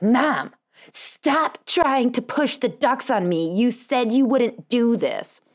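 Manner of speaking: mock exasperated kid, stretching the words; imitated kid's yelling. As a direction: imitate an exasperated daughter telling Mom off. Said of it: "I just got yelled at".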